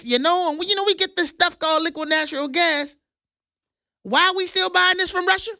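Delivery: puts on a high, chummy voice for the EU president; he mimics the EU president with a mocking high voice; mock von der Leyen. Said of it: "sounded something like this, of course, with her European accent, but I'm gonna translate it".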